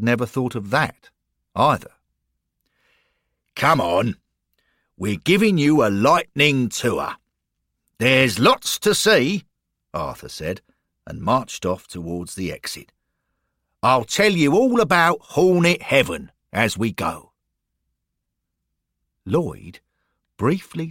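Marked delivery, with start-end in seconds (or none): 3.5-9.4 louder, higher, rousing tour guide; rally him along with hearty energy; Arthur the brisk guide. Acted. Guide hurrying Lloyd off on a tour, rousing. 13.8-17.3 lightly voiced, louder and higher guide; Arthur the keen guide. Guide promising Lloyd the whole story, hearty.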